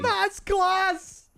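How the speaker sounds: high, delighted cry of praise; delighted exclamation. Real, not acted. Streamer cheering a viewer's donation poem, high delighted cry.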